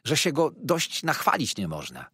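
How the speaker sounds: mildly fervent admiration; warming to the subject. A narrator's reading. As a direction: gush a little, pitch lifted. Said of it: "zapalając się do przedmiotu".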